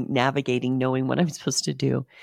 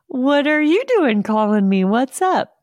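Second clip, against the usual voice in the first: high, loud delighted phone greeting; acting out a gleeful, full-voiced hello; excited greeting; 9 semitones higher, 10 dB louder than usual. Greeting a beloved clinic worker on the phone, excited.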